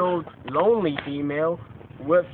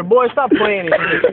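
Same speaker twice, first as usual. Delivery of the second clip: mock mom scolding; 5 semitones higher, 4 dB louder, slower than usual. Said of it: "She yelling at me".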